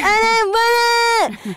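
high, drawn-out, slow crying shout; toddler tantrum wail. Retold or imitated. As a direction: wail it like a two-year-old refusing his lunch.

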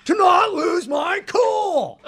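slow, high, cracking yell building to a scream; Nicolas Cage line. Pretend. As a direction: blow up like Nicolas Cage losing his cool. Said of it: "do you remember a line that you had to do".

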